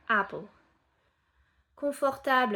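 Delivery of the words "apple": "Apple" with a short A, loud. Shown as a demonstration. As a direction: say "Apple" as aple. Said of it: "apple, pas apple".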